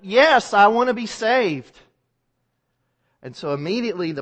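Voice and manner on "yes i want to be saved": desperate call up. Acted. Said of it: "the canoeist calls up".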